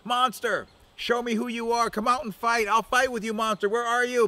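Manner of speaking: high, loud taunting call; high boastful shouting challenge; Crow yelling. Acted. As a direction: dare the monster to come out and fight. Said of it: "and Crow said".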